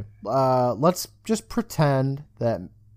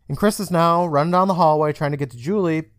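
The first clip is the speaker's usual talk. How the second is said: ordinary talk, nothing marked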